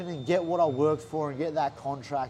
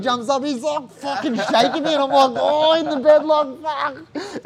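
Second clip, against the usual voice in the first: overjoyed yelling; 9 semitones higher, 8 dB louder than usual. Retold or imitated. Reliving the room erupting.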